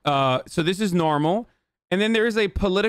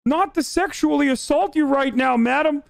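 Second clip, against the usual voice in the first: put-on babyish creep character; 10 semitones higher than usual. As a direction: whine it like an overgrown baby. Acted absurd creep character.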